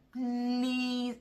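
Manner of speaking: pleading, held on a high note; begging plea. Real, not acted. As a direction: beg them with one stretched-out word. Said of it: "I am begging you".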